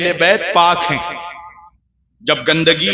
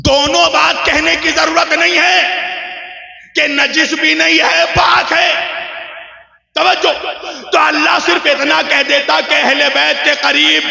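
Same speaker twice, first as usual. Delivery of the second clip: high, ringing oratorical argument; full-force preaching; 7 semitones higher than usual. Real, not acted. Preacher argues the verse's double wording to the congregation, full-force preaching. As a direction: build the argument in sustained proclamations.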